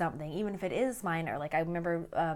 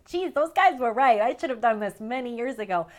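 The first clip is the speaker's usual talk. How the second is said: louder, a touch higher, wry admission; mock future regret; 3 semitones higher, 9 dB louder than usual. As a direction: concede it with a rueful laugh in the voice. Imagined future self admitting defeat.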